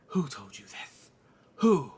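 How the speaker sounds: low, slow demand, then loud; Rhaenyra. Acted. Mother questioning slur on her children, slow demand. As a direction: question slowly, punch the last word. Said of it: "then Rhaenyra says".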